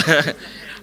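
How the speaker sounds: loud, high burst of laughter; amused laugh. Real, not acted. Preacher amused by worship selfies, incredulous laugh. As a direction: laugh out in disbelief.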